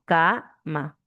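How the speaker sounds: "cama" as a long ca, a gap, then ma; split into syllables. Shown as a demonstration. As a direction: separate "cama" into two syllables, ca and ma. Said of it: "Si nosotros separamos cama".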